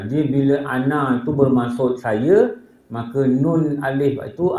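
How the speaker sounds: usual speaking voice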